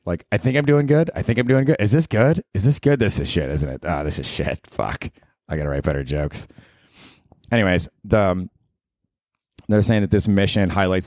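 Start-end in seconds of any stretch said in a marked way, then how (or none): 0.3-3.0 high, hopeful anxious comic; fishing for approval with rising hope; insecure comic. Retold or imitated. Comic on stage asking the crowd, high and anxious. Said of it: "stand-up comic type of insecurity". 3.0-6.5 low, quiet self-loathing mutter; sinking into despair under the breath; deflated comic. Retold or imitated. Comic bombing on stage, low and defeated. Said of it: "stand-up comic type of insecurity".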